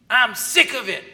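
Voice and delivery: imitating an angry complaint; mock fed-up snap. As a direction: spit it out like a fed-up spouse. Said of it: "you hear people talk that way?".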